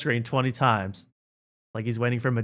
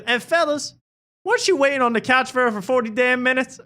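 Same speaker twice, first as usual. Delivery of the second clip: lightly voiced hype comic, higher; comedian crowd work; 12 semitones higher, 4 dB louder than usual. Cat Williams addresses the ladies, lightly voiced hype. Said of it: "He goes".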